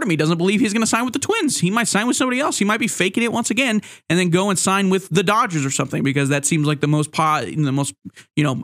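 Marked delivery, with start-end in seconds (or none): none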